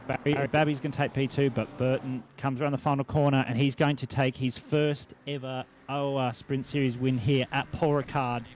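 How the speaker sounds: rising excited finish call; race caller. Acted. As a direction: call the winner home. Calling the race win.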